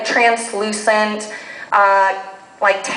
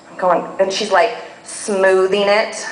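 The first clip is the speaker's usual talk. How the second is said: ordinary delivery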